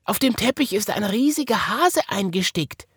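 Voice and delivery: high bunny voice; Wiggle's voice. A narrator's reading. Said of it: "rief die Häsin".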